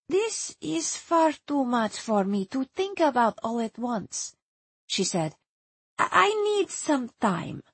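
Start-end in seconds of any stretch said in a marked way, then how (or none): 0.1-4.3 put-on softer accented character voice, tailing away; weary Hungarian woman. Erzsébet tells the visitor it is too much, weary accented voice. 6.0-7.6 put-on high, halting accented voice; hesitant Hungarian woman. Erzsébet asks the visitor for time, hesitant high voice.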